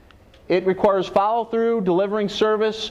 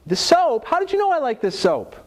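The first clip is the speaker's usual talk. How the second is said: much higher, delighted disbelief as the customer; surprised customer; 9 semitones higher than usual. Retold or imitated. HR rep imitates the once-angry customer finding her favorite soap, high and delighted. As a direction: gasp it out, thrilled and amazed. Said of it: "she says".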